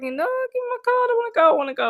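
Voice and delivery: high, hammed-up plea; desperate to leave. Acting out escape from an awkward chat, high pleading. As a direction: plead to get away, pitched right up.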